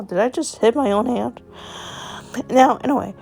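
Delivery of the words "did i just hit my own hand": ordinary delivery, nothing marked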